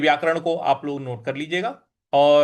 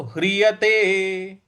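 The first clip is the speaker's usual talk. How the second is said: slow, careful Sanskrit recitation; teacher modelling a verse slowly; slow recitation demo; much slower than usual. Shown as a demonstration. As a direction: recite syllable by syllable for the learners. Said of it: "ह पर आधा र लगा हुआ है".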